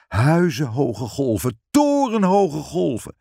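plain reading voice

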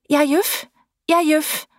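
put-on high, small, scared children's voices; timid girls answering from the dark water; anxious little voices. A narrator's reading. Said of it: "benauwde stemmetjes". (hoge, benauwde kinderstemmetjes)

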